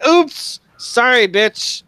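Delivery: high, loud gleeful insincerity; mock apology. Husband kicks his wife's plants apart, gleefully mock-sorry. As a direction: chirp a fake sorry while wrecking things.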